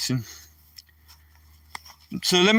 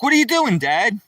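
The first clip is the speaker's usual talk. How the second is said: surprised daughter; 6 semitones higher than usual. Retold or imitated. Daughter voiced in story.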